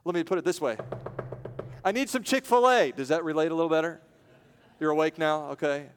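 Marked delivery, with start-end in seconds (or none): 1.8-2.9 higher, louder comic craving; preacher updating the friend's plea, loud and playful. Acted. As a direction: beg for it with a grin. Modern version of the plea.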